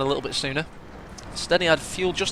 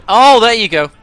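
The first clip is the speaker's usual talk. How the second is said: much higher, much louder exclamation; excited outburst; 7 semitones higher, 16 dB louder than usual. Real, not acted. Caster cheers the Panther's arrival, much higher and louder. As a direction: burst out at the big moment.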